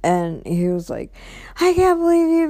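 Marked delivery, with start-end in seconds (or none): 1.6-2.5 mock angry boyfriend. Boyfriend's angry outburst retold.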